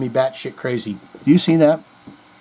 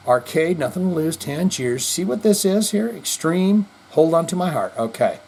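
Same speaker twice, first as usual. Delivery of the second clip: usual speaking voice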